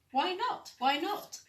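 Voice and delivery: put-on high, loud little Gruffalo's child asking; eager child voice. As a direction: pester Dad like a curious child. Child questions her father.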